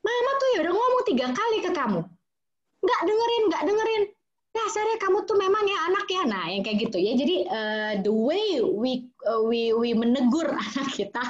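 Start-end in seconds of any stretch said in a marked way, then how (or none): 0.0-6.2 much higher and fast, exasperated scolding; nagging scold. Shown as a demonstration. Mother scolding a child who didn't listen, exasperated. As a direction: blame the child in a rush. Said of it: "Akan beda dengan gini".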